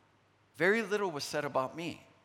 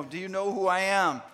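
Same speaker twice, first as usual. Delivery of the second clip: louder, mock offended who-I-am; mock indignation; 3 semitones higher, 6 dB louder than usual. Pastor mimics his offended pride, mock indignant. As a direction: act out a bruised ego, half joking.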